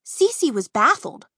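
ordinary delivery, nothing marked

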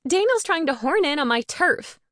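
high, loud, put-out complaint; indignant huff. A narrator's reading. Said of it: "she huffed".

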